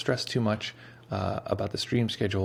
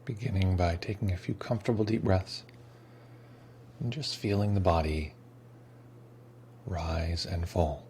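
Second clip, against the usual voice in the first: guided meditation; 7 dB quieter than usual. Acted. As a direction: ease them into stillness, unhurried. Leads the meditation.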